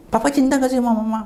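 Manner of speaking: high, quick insistent question. Retold or imitated. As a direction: re-enact pressing a father through tears. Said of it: "Suara agak tinggi sambil nangis saya". (bertanya mendesak dengan suara tinggi)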